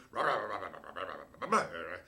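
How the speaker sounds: low gruff gibberish bark; mock barking in Japanese. Manager barking gibberish at his player. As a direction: bark nonsense syllables like an angry manager. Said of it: "he's barking stuff at me".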